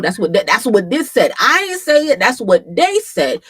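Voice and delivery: loud, high, insistent denial; emphatic disclaimer. Real, not acted. Streamer to viewers about the rumor, emphatic disclaimer. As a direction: push it out loudly, refusing the blame. Disclaims a shocking claim, stressing it.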